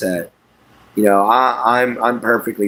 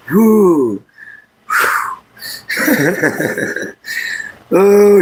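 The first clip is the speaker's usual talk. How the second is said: tearful sigh; 9 semitones higher, 4 dB louder than usual. Real, not acted. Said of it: "big blubbering baby".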